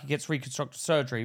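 normal talking voice

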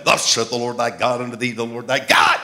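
loud, declaimed prophet imitation; mock prophesying. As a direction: proclaim it like a would-be prophet. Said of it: "God doesn't speak like that anymore".

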